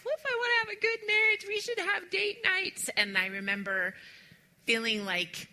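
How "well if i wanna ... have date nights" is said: high anxious inner voice. Retold or imitated. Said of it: "and I felt like".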